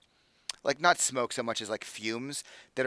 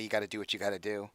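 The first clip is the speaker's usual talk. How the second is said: quick, quiet and pointed; 3 semitones lower, 5 dB quieter, faster than usual. Acted. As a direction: say it pointedly, with a look. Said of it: "rather pointedly".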